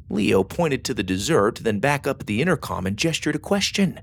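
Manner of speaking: ordinary delivery, nothing marked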